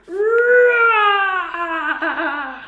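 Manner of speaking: long loud roar; bear roar. A narrator's reading. Bear roars.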